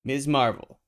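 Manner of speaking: announcer title. Acted. Reading the comic title.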